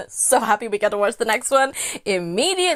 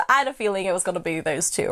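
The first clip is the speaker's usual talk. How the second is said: ordinary talk, nothing marked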